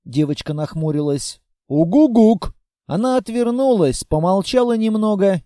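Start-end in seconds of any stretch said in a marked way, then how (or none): 1.7-2.5 loud hooting burst; double owl hoot. Acted. Monster hoots a loud double reply at the girl. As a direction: hoot twice, insisting.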